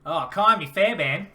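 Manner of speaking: loud, drawn-out Australian take on Kaimi Fairbairn; hammed-up Australian accent, r's dropped in Fairbairn. Shown as a demonstration. Co-host takes up the challenge to say it in an Australian accent. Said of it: "try to say that with an Australian accent".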